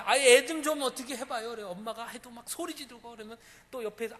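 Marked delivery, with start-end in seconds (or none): 0.0-1.5 annoyed passenger. Retold or imitated. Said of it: "엄마한테".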